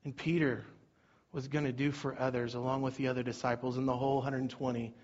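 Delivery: a hushed, earnest ending to the sermon; soft closing sermon. Acted. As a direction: bring it down for the close.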